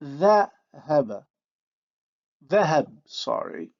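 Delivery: Dhahaba with a backed vowel; emphatic dh, back vowel; Arabic emphasis demo. Shown as a demonstration. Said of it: "look at the examples".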